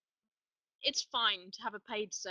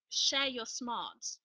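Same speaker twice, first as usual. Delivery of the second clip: slow quoted motto; much slower than usual. Retold or imitated. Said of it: "he said".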